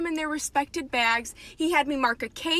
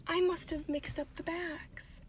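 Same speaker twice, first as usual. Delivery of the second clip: much softer, slow and sheepish; hushed guilty admission; 3 semitones higher, 11 dB quieter, slower than usual. Real, not acted. Clerk confessing the bag mix-up, hushed and sheepish. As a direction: drop almost to nothing, guiltily. Guilty realization about the bags.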